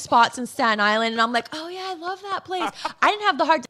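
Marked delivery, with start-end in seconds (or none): 1.5-2.7 fake enthusiasm. Faking enthusiasm to [name].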